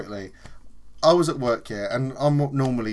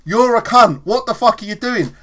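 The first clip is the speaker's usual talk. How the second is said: higher, louder mock outburst; mock angry yell; 8 semitones higher, 10 dB louder than usual. Worker retelling how he'd normally yell at a coworker, higher and louder. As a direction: snap at the man who splashed you. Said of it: "normally I'd be like".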